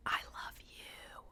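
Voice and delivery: slow breathy whisper, barely there; whispered love confession. Acted. Boyfriend whispering to his sleeping girlfriend, hushed whisper. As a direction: whisper it so she might not hear.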